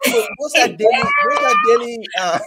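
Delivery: climbing laughing yell, repeated; laughing shout. Real, not acted. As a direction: shout it through a fit of giggles. Laughing over shared joke.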